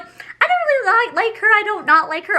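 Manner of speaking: high, put-on inner voice; squeaky quote of her own thought; high playful self-quote; mock inner voice.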